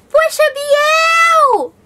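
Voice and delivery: high, slow, loud exclamation; playful high cry of dismay; excited exclamation. Acted. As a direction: stretch it out in mock dismay. Mock reproach to viewer.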